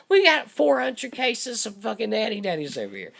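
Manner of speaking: lightly imitated press-conference voice; official announcement. Acting official's briefing beside interpreter.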